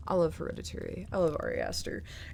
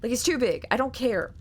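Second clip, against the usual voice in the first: normal talking voice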